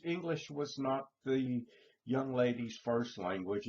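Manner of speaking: normal talking voice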